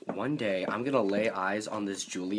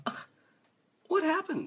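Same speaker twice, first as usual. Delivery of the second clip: imitating parents. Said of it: "my parents look at me and go like".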